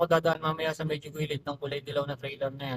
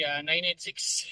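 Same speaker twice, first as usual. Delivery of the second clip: ordinary talk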